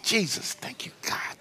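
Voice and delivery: soft sigh dropping into a whisper; hushed, dreamy gratitude; whispered thanks. Pretend. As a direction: breathe out mock-reverent relief. Acted awe at imagined tidy closet.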